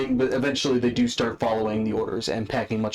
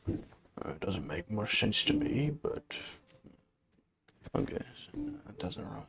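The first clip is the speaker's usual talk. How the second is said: muttering; 9 dB quieter than usual. Acted. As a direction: mutter it half to yourself. Orc muttering over scroll.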